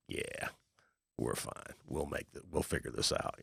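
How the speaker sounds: calm yourself down under your breath; quiet reassurance. Retold or imitated. Investor calming himself about a bad deal, softly. Reassuring self in hard deals.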